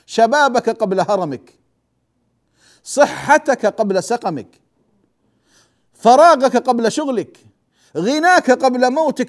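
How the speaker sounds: slow, measured listing of each pair; solemn preacher reading the saying to listeners; slow hadith recitation. Acted. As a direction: recite each line deliberately with pauses.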